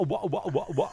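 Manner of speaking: mocking English chef. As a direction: parrot the chef's accent as a silly wah wah. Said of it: "his particular English accent always just sounded like a wah".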